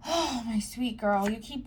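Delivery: slow, tender comfort. Real, not acted. Reader comforting a viewer who blames herself, slow and tender. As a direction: soothe her gently and slowly.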